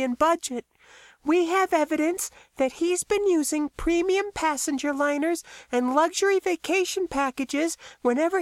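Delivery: very high-pitched, even character voice; earnest Talia. A narrator's reading. Talia explains her research to Max, high and earnest. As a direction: lay out evidence in a high, measured voice.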